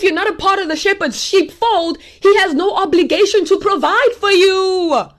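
full-force preacher's declaration; forceful preaching. Real, not acted. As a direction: drive the warning home.

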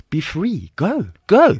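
high, rising to a loud shooing cry; urging. Acted. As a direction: send them off with mock desperation. Parents urging grown child to leave.

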